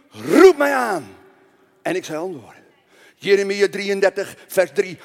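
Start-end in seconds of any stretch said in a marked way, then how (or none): 0.1-1.2 loud, slow, drawn-out call; emphatic proclamation. Shown as a demonstration. Preacher answering what to do in trouble, loud slow proclamation. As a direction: stretch it out as a rallying answer.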